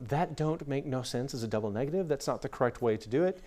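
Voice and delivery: softly spoken pedantic English major. Acted. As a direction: correct the grammar quietly, like an English major. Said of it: "an English major would say".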